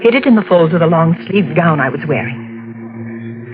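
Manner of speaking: low, measured radio-drama narration; hushed suspense narrator; story narration. A narrator's reading. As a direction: tell it like a fading memory of horror. Narrator recounts the search.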